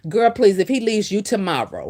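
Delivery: usual speaking voice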